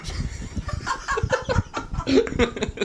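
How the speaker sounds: high, breathless shriek of laughter. Real, not acted. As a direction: burst into helpless laughter. Huge burst of laughter.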